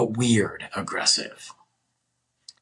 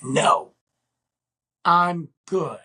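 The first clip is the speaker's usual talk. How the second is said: "No", long pause, then "I'm good" pitched up; retold firm refusal; 6 semitones higher, much slower than usual. Refusing her demand to come along, firm spaced-out refusal. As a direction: break the refusal into separate, weighted pieces.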